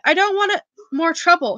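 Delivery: ordinary delivery, nothing marked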